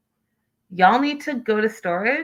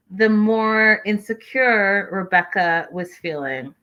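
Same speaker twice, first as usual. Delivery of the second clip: normal talking voice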